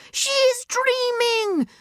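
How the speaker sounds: high, slow, anguished wail; drawn-out high cry of distress; old man's wail. A narrator's reading. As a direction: wail in protest, stretching the words. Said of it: "one old man wails".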